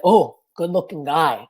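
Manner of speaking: dad voice. Retold or imitated.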